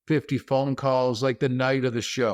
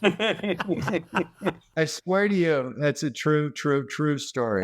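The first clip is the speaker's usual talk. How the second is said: laughing; much slower than usual. Real, not acted. Laughs through his vow.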